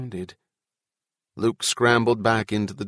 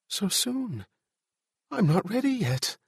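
helpless; 10 semitones higher than usual. A narrator's reading. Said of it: "feeling helpless".

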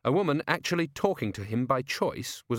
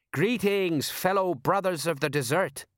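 higher, hammed-up desert greeting; badly faked local, hailing the brothers; mock Clatchian talk; 7 semitones higher than usual. A narrator's reading. Colon posing as a Clatchian to strangers, hammed-up greeting. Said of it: "Anyone knew how Clatchians talked".

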